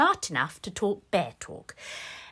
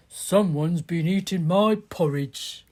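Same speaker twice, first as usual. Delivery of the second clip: a lightly voiced, lower bear dad; Daddy Bear; 4 semitones lower than usual. Daddy Bear complains about his eaten porridge, lightly lowered voice. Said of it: "Daddy Bear picked up his bowl and said".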